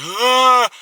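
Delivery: loud, high mock gasp of terror; high-pitched acted "Ah!" of panic; imitated panicked scream. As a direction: mimic a passenger freaking out mid-flight. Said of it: "this guy's freaking the fuck out".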